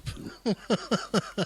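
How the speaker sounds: light high giggle; high laugh. Real, not acted. Host laughing at the sister's no. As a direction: laugh along at the punchline.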